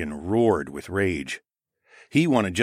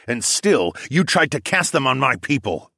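raised, angry accusation; growled; 6 semitones higher, 4 dB louder than usual. A narrator's reading. Fighter accuses captured mage over his people, growling. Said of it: "I growled".

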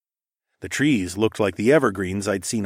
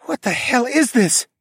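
high-pitched disbelief; stunned, high exclamation; breathed in shock; 4 dB louder than usual. A narrator's reading. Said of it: "Tawa breathed".

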